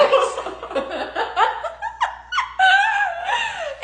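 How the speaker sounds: a high, loud burst of laughter; the friend's type gives it away, a shrieking laugh. Real, not acted. Punchline of the reveal.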